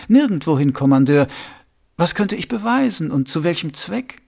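sly, confiding ruler asking a pointed question; Vetinari voice. Acted.

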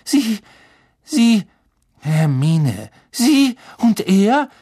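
hoch und laut, stockend; zornige Anklage. A narrator's reading. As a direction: fassungslos beschuldigen. Hermine zornig, stammelnd, anklagend. (Hermine zornig zu Lupin, schrill anklagend)

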